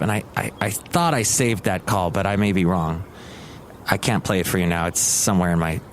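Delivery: ordinary talk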